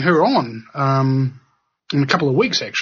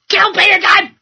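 high, loud yelled outburst; mock outburst; 6 dB louder than usual.

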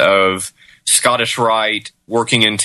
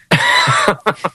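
laughing. Real, not acted. Laughs at sex side effect.

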